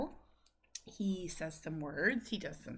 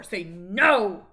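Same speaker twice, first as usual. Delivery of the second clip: louder urging; 10 dB louder than usual. Shown as a demonstration. Mother urging her son to say no louder. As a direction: push it out louder. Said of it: "Say it louder".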